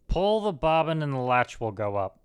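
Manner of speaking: usual speaking voice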